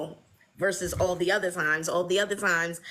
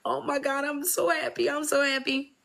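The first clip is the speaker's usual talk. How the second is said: a touch higher, happy gushing; overjoyed friend; 7 semitones higher than usual. Retold or imitated. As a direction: gush with joy. Friend calling back overjoyed.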